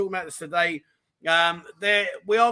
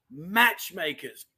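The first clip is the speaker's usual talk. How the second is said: ordinary talk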